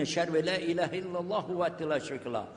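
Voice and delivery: rapid Arabic recitation on a narrow tune; formal opening praise, quickly recited; Arabic opening invocation. Real, not acted. Speaker opening his lecture with Arabic praise, recited formally.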